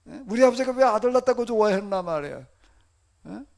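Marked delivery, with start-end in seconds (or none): 0.3-2.5 loud acted complaint; lament. Preacher voicing Jeremiah blaming his father's joy, loud lament. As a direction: protest it loudly, as a retold lament. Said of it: "이렇게 탄식을 하고 앉았는 거예요".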